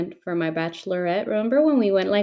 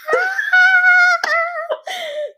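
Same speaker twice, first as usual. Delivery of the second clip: high, screaming laugh at the stage; excited shriek; 4 dB louder than usual. Retold or imitated. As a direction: shriek with delight for the DJ. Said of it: "screaming to make sure he would hear me".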